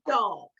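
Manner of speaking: Dog as one loud clapped beat; clapped out with the class. Shown as a demonstration. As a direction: say it firmly as one clap. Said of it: "Let's do it together".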